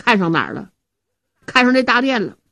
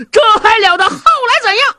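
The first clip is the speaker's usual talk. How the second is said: high, loud anxious cry; alarmed outburst; 12 semitones higher, 6 dB louder than usual. Acted. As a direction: blurt it out in panic. Zhao Hu alarmed at tiger news. (惊慌地高声喊叫)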